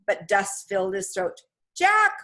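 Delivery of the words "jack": short, high, loud call; high-pitched cry for help; shouted name. A narrator's reading. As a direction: shout for him urgently. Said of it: "Annie shouted his name".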